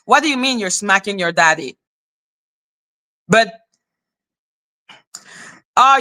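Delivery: ordinary delivery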